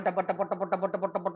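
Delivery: low chugging tubba tubba; truck rumble. Acted. Farmer's truck passing, low chugging rumble.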